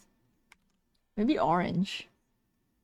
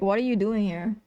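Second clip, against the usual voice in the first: usual speaking voice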